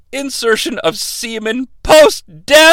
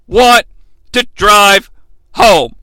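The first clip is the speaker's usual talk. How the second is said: ordinary talk, nothing marked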